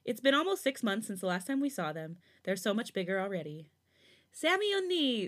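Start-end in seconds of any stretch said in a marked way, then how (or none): 4.3-5.2 put-on high, excited little-girl squeal; squealing child. A narrator's reading. Little girl running to her aunt, squealing happily. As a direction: squeal her name like a delighted kid. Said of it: "Sujin squeals".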